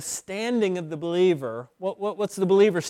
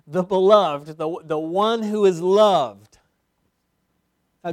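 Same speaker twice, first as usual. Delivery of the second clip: usual speaking voice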